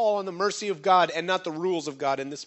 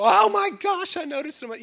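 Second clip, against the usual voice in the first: high-pitched mock gasp of alarm; exaggerated oh-my-gosh panic, pitch leaping up; mock shock; 11 semitones higher, 4 dB louder than usual. Preacher acting out an overreaction the congregation should avoid, mock shock. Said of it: "don't go".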